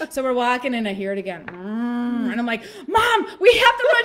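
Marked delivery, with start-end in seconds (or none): 2.9-4.0 high, loud urgent holler; retold frantic yell; panicked shout. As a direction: shout at mom to get moving. Retelling panicked shout to mom.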